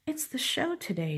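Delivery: a touch of exasperated scolding; angry farmer. A narrator's reading. Farmer upset on the day of the show, mildly angry. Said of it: "Missus Boot is angry".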